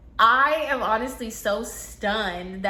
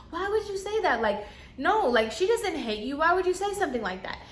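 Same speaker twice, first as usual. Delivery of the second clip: high, mock-soothing disbelief; imitating consoling coworker; 7 semitones higher than usual. Coworker reassuring the crying client, higher and mock-soothing. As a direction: pitch up like a sweet girl comforting.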